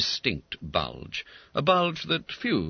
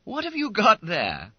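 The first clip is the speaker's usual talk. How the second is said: schoolboy voice; 14 semitones higher, 3 dB louder than usual. A narrator's reading. Bob Cherry's voice.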